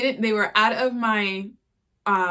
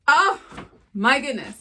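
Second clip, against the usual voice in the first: excited gasp; 5 semitones higher, 5 dB louder than usual. Real, not acted. Opening second shoe box.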